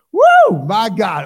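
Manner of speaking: explosive joyful church whoop; ecstatic woo. Real, not acted. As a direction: shout woo to the rafters. Preacher shouts in worship.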